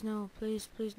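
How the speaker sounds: ordinary delivery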